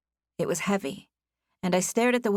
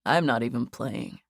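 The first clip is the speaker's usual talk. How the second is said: light male character voice; lower male voice; 6 semitones lower than usual. A narrator's reading. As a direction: drop into a lower voice for him.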